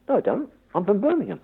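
lightly imitated Birmingham accent; a hint of Birmingham accent in a mock denial. Londoner imitating Birmingham locals denying their accent, light Birmingham accent. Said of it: "in Birmingham, they say".

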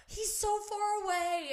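high, loud, drawn-out re-enacted cry; dismayed mock wail. Runner sees the truck far off, dismayed mock wail. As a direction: wail it slowly in comic despair.

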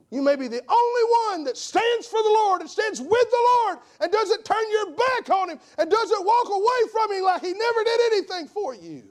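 high, loud preacher's shout; preaching at full force. Acted. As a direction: thunder it from the pulpit.

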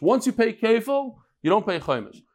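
normal talking voice

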